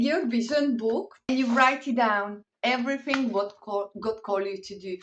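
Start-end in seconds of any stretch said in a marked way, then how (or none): none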